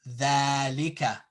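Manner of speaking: dhalika with a long first a; long vowel. Shown as a demonstration. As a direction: hold "dhaa" in dhalika. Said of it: "This is the way it sounds".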